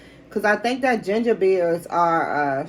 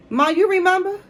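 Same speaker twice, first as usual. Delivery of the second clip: raised, higher call across the room; 10 semitones higher, 3 dB louder than usual. Real, not acted. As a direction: call out to someone nearby. Calling to mom off-mic.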